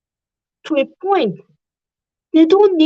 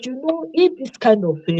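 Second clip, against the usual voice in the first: ordinary talk, nothing marked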